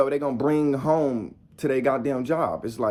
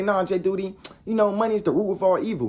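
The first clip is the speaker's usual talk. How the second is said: lightly mimicked objection, pitched higher; higher-pitched mock protest; objector's voice; 7 semitones higher than usual. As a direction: imitate a naysayer in a higher voice. Voicing other people's objection.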